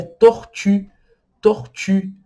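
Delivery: tortue with rounded French u. Shown as a demonstration. Teacher models tortue in French. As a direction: say tortue, lips round for the final u. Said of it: "a palavra tartaruga, em francês".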